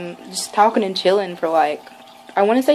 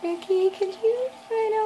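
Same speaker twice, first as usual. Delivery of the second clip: high, tiny nervous fan voice; timid request; 11 semitones higher than usual. Retold or imitated. Fan asks Ricky for a tattoo, high timid re-enactment. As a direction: squeak out a shy request. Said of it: "I walked up and I was like".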